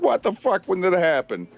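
high mock-shocked outcry; shocked disbelief. Woman seeing her posted picture, mock shock.

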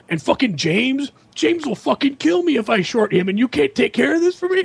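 frantic whine rising high; a friend begging for help, voice climbing; mock panic. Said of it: "He's like".

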